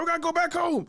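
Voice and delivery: high-pitched shouted imitation of worked-up friend; frantic insistence.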